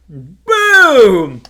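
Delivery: shouted gunshot boom, falling; loud boom. Retold or imitated. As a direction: yell it like a rifle shot. Gunshot sound effect.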